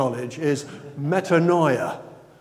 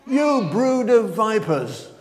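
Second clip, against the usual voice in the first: fiery denunciation; 6 semitones higher, 5 dB louder, slower than usual. Retold or imitated. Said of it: "And what words he used?".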